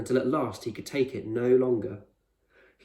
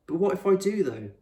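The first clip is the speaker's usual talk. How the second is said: put-on higher, louder insistent worried boy; anxious boy; 9 semitones higher, 3 dB louder than usual. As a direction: push back, scared and needing reassurance.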